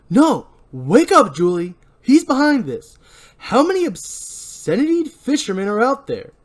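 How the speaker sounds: high, loud insistent yelling; accused man yelling at his friends; shouting protest. Acted. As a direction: shout to snap her out of it. Misattributed Ray line, shouting.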